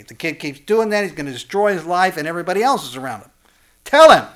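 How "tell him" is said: high, loud, punched command; sudden shout. Real, not acted. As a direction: bark it at the timid parent. Urging parents to confront child.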